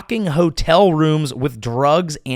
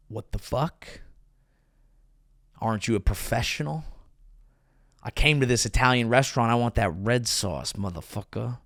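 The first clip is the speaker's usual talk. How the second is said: low disgruntled complaint; 3 semitones lower, 6 dB quieter than usual. Retold or imitated. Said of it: "he'd be like".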